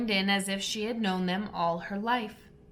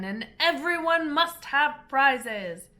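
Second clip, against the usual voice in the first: higher, louder pompous declaration; grand dodo; 6 semitones higher, 5 dB louder, slower than usual. A narrator's reading. Dodo declares prizes for everyone, pompously. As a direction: proclaim it like a magistrate.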